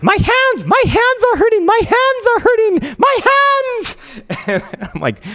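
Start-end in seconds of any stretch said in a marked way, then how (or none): 0.0-4.0 put-on very high, loud squeaky panicked girlfriend voice; imitating Elsa. Retelling his girlfriend panicking while swimming, squeaky high imitation. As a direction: wail it in a tiny high cartoon voice. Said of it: "and she's like".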